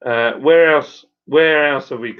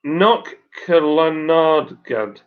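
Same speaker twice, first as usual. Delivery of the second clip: "Knock Killarnagad" sounded out slowly, syllable by syllable; slow, deliberate go at saying "Knock Killarnagad"; careful second attempt; much slower than usual. Shown as a demonstration. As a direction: stretch out "Knock Killarnagad" with a gap after "Knock". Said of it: "Shall I have another go?".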